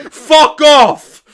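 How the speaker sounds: loud, high mock outrage; exasperated outburst. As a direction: cut in with a hammed-up angry yell. Exploding at the character's change of heart.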